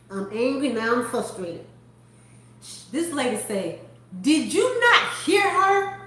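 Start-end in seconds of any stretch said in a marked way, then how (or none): 4.2-6.0 high, slow mock scolding; incredulous, drawn-out reprimand; indignant challenge. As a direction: imitate an indignant customer confronting a clerk. Customer acted, scolding the clerk.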